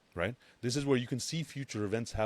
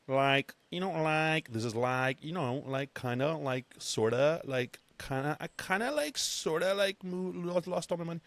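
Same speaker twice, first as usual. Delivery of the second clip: high, rambling mock panic; mocking panicked beginner; 8 semitones higher than usual. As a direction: babble like a clueless newbie who lost it all. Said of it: "I'm just going for the joke".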